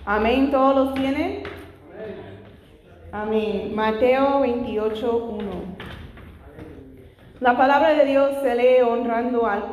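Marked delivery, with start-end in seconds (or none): none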